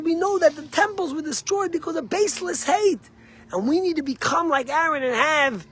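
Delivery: high, loud, impassioned sermon voice, slowing on key phrases; fervent preaching. Acted. Rabbi urging his listeners to love like Aaron, preaching at full force. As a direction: preach it out to the whole congregation.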